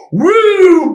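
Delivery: high, drawn-out "woo" of someone flipping out; high imitated whoop.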